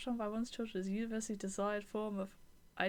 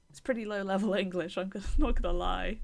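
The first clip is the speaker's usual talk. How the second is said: laughing aside; 2 semitones lower, 9 dB louder, faster than usual. Real, not acted. Laughing about basic English.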